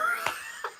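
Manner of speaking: high squeal of a laugh. Real, not acted. Vlogger cracking up at Destiny's slap threat.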